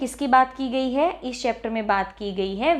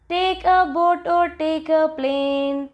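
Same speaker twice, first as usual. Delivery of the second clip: slow, higher chanted verse; sing-song children's rhyme, drawn out; poem recitation; 4 semitones higher, much slower than usual. Shown as a demonstration. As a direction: recite slowly, stretching each word evenly. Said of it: "so let us recite this".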